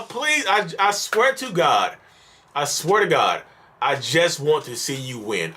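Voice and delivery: ordinary delivery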